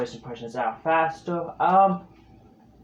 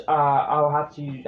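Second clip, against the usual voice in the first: normal talking voice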